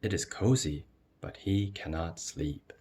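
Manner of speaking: plain reading voice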